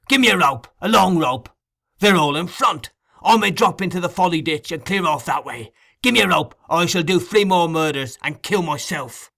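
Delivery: loud, high, frantic orders; a trapped murderer demanding a rope; desperate demands. A narrator's reading. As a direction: bark for a rope in panic. Sikes desperate to escape.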